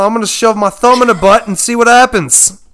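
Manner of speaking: loud, high-pitched Australian showman; excited Aussie nature host presenting a wild catch; Steve Irwin. Acted. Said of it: "Steve Irwin curses a lot".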